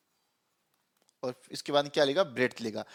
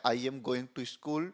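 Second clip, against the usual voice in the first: slow example sentence; 3 semitones lower, 7 dB quieter, much slower than usual. Shown as a demonstration.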